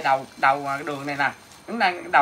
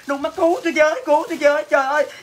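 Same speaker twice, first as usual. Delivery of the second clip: higher, panicky plea for rescue; mock cry for help; 6 semitones higher, faster than usual. Neighbour begging for rescue from the fire, hammed-up panicky cry. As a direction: wail like a neighbour whose house is burning. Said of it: "Bà la".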